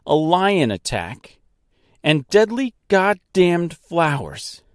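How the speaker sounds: worked-up rant, short of a real scream; furious tirade. Acted. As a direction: list each ordeal with mounting outrage. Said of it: "screaming at the top of her lungs".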